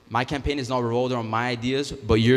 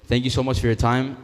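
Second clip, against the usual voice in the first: normal talking voice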